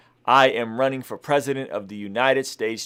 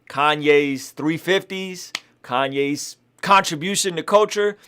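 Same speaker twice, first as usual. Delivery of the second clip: ordinary talk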